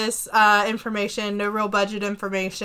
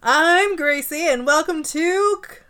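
drawn-out, higher announcer voice; game show host; 6 semitones higher, 3 dB louder than usual. Pretend. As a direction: hype the welcome like a TV host. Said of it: "I've got that game show announcer voice".

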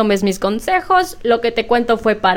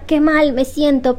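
slow, higher mock complaint; pretend sick moan; 5 semitones higher than usual. Acting out a sick day in bed, mock moaning. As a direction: lightly play the sorry patient, drawn out. Voicing herself sick watching a film.

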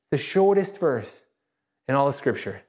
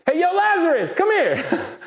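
high, playful holler far above usual pitch; calling out; 14 semitones higher, 4 dB louder than usual. Acted. Jesus summoning Lazarus from the tomb, high jokey call-out. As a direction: summon someone from a distance, comically casual. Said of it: "and then he said".

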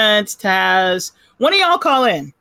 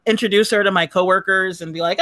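ordinary delivery

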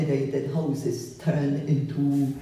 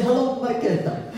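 higher voice for a retold hello; lightly imitated greeting; 8 semitones higher than usual. Blind Irish musician greeting the photographer he recognizes, lightly imitated higher voice. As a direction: lightly play someone recognizing a familiar visitor. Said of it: "And he would say".

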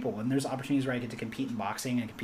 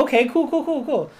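high, loud, rapid-fire cools; mock agreeable; 12 semitones higher, 10 dB louder than usual. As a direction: overdo the nervous approval. Mock reaction to extreme stone lifting.

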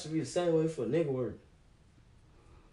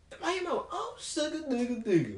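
high, lightly mocking mama voice; imitating mama; 8 semitones higher than usual. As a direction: copy the doting mama coo.

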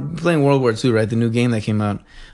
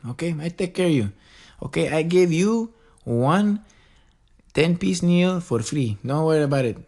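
put-on higher-pitched, slightly accented service-worker voice; mock obliging employee, pitched up; accommodating employee; 4 semitones higher than usual. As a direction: placate the customer in a put-on voice. Acts out accommodating restaurant employee offering meal.

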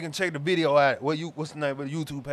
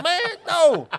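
exasperated rant; 9 semitones higher, 5 dB louder than usual. Real, not acted. Ranting about school tasks.

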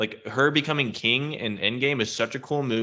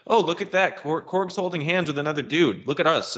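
mock boasting, pitched up high; high, excited mock bragging; self-congratulatory; 8 semitones higher than usual. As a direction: imitate someone showing off proudly. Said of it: "feels all very self-congratulatory".

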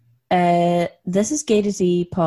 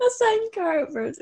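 high, laughing through the words; can't get the phrase out straight for laughing; 12 semitones higher, 4 dB louder than usual. Real, not acted. Cracking up at own joke.

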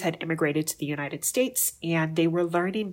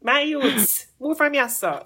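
loud, high Jamaican Patois dismissal; animated Jamaican street talk; 9 semitones higher, 3 dB louder than usual. Acted. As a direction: shoo them away in Jamaican Patois.

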